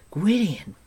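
high, loud cry of disbelief; astonished exclamation. A narrator's reading. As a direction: blurt the name out, stunned. Taran astonished at the stranger's name.

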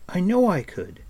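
high, loud insistence; youthful, heated objection; insistent protest. A narrator's reading. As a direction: insist hotly, voice jumping up. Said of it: "Taryn protested".

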